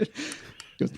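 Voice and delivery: ordinary delivery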